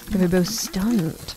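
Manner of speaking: ordinary delivery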